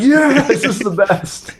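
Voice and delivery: high, slow celebratory cry; elated. Retold or imitated. Hunter reliving his small-buck win, elated. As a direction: stretch it out high, reliving the joy. Retold joy over the one buck.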